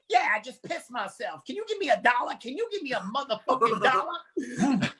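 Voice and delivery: lightly voiced bum begging for a dollar; begging homeless man.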